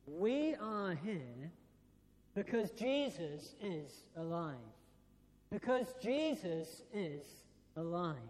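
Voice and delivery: slow, weighty proclamation with a strong stress on Jesus; slow solemn declaration. Retold or imitated. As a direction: lay out each word slowly so the crowd hangs on it. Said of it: "he simply declared like this".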